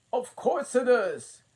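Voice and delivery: high, loud bossy queen. A narrator's reading. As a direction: boss her along. Queen's line.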